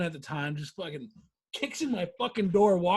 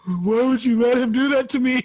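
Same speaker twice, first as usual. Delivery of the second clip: put-on whiny drunk friend; 4 semitones higher, 9 dB louder than usual. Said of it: "he goes".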